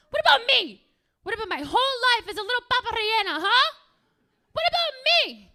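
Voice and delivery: high, loud wronged wail; hammed-up outraged yelling; indignant outburst. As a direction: shout your grievance at the crowd. Comic outraged plea about her life.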